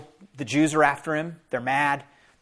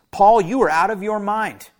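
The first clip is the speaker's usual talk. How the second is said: raised, higher accusing shout; loud voice; 4 semitones higher, 6 dB louder than usual. A narrator's reading. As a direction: call Paul mad loudly before the court. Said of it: "Festus said in a loud voice".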